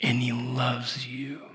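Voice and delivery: slow, softening, simple reassurance; lightly voiced, tender little boy; tender young son. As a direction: imitate a child gently explaining, trailing to a hush. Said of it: "But he goes".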